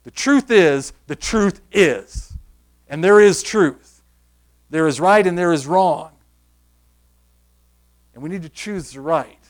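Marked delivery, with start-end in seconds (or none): 0.0-2.3 louder, very slow "truth is"; emphatic repeat. Shown as a demonstration. Preacher pressing the congregation, loud slow emphasis. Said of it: "Did you hear me?". 2.9-6.1 preaching force. Real, not acted. Preaching climax.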